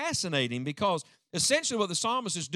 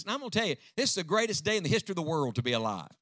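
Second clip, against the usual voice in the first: ordinary talk, nothing marked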